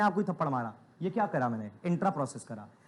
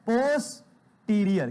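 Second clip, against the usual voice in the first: slow, stretched pronunciation of posterior; drawn-out term; much slower than usual. Shown as a demonstration. Teacher drills students, slowly stretching posterior. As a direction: spell it out with sound, slowly. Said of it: "क्या बोलोगे बेटा?".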